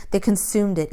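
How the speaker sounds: ordinary delivery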